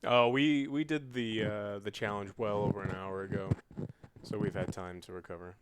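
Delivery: ordinary delivery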